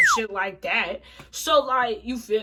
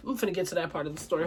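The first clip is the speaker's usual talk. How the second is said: normal talking voice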